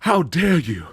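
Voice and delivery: outraged British father, pitched high; over-the-top British. Retold or imitated. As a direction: ham up the British indignation. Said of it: "But he's all like".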